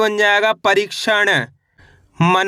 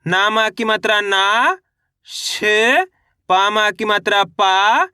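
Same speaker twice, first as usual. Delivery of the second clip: slow reading drill, each final syllable held and rising; syllable-by-syllable demo; slower than usual. Shown as a demonstration. Teacher showing learners how to pronounce the word, syllable by syllable. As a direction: spell out the vowel sign, then stretch the syllable. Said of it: "अब उच्चारण किस तरीके से करेंगे".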